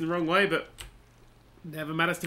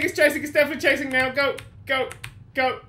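ordinary talk

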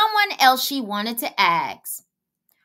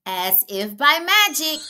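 plain reading voice

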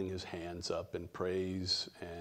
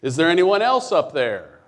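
higher, loud shout upward; man refusing God's answer, shouting louder and higher; shouted punchline; 8 semitones higher, 14 dB louder than usual. Retold or imitated. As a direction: yell up the cliff for someone else.

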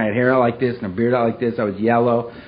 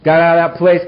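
normal talking voice

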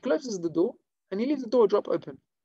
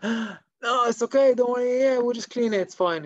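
higher-pitched, hurried-sounding brush-off; urgent reassurance; 6 semitones higher, 4 dB louder than usual. Acted. Scalded man excusing the shamash, urgently reassuring. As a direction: insist all is fine through the shock.

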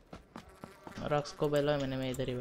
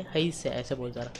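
ordinary talk

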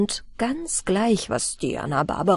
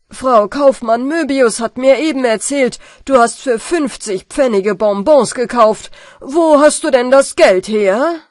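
loud stern questioning; stern father; 3 semitones higher, 9 dB louder than usual. Acted. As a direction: demand an answer. Father confronts son.

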